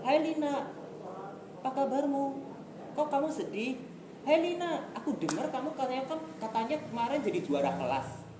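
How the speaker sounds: high, eager hello to a friend; cheering up a sad classmate; encouraging greeting. Shown as a demonstration. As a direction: lift her up with praise. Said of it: "contohnya tadi saya bilang".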